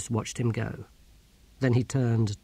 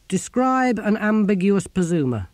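sceptical challenge; 8 semitones higher, 5 dB louder than usual. Acted. Ern sets a sceptical test.

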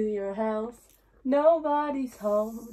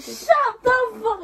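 high loud amazed outburst; amazed shout; 11 semitones higher, 7 dB louder, slower than usual. Real, not acted. Friend reacts to the great singing, loud amazed shout. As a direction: yell it in disbelief. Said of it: "You are so good".

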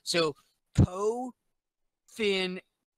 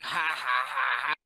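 Palpatine laugh; 2 semitones lower than usual. Retold or imitated. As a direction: cackle it out like an old evil emperor. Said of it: "he's just laughing like".

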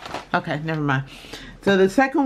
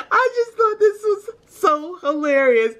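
much higher, laughing, teary; helpless with laughter; 11 semitones higher than usual. Real, not acted. Apologizing to viewers, laughing helplessly. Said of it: "I can't stop laughing".

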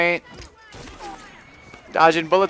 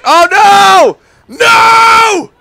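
anguished screams; 14 dB louder, much slower than usual. Real, not acted. Carriage wrecked in game.

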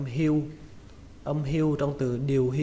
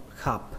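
"khập" short, softer and falling; heavy low tone; 2 semitones lower, 5 dB quieter than usual. Shown as a demonstration. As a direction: drop "khập" down and cut it short. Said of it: "Các bạn thêm dấu nặng cho nó". (đọc "khập" với dấu nặng)

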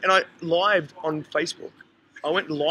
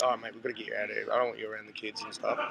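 lower, softer, fast mate voice; mate sending him off; 9 semitones lower, 9 dB quieter, faster than usual. Retold or imitated. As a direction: hurry him out quietly. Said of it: "my mate was like".

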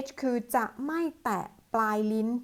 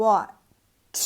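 watch drawn out very slowly so the ch tongue tap can be felt; 7 dB louder than usual. Shown as a demonstration. Teacher says watch very slowly so students feel the tongue. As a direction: stretch watch out, placing the tongue tip deliberately. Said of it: "ช้าๆ".

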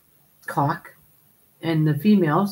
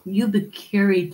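usual speaking voice

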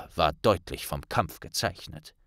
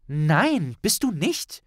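high, sharp, scolding; stern rebuke; 8 semitones higher, 4 dB louder than usual. A narrator's reading. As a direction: snap at him like a strict friend. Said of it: "fuhr ihn Hermine streng an".

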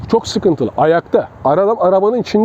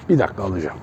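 much lower, softer, mock-courteous request; mock polite attacker; 11 semitones lower, 7 dB quieter, faster than usual. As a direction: play the absurdly polite gun-grabber. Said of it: "kimse şunu yapmaz".

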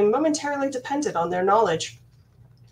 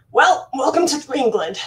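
mocking welcome; 4 semitones higher, 6 dB louder than usual. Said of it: "He laughed and dropped into a mocking bow".

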